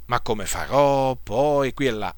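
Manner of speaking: slow, subdued mock fretting; imitating worry. Preacher voicing a believer who starts to fear, mild worry. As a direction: fret softly, drawing the words out. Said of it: "Se uno comincia ad avere paura".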